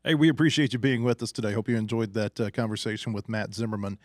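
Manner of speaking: loud, booming show sign-off; announcer outro. Acted. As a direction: project the outro like a radio host. Host closing outro announcement.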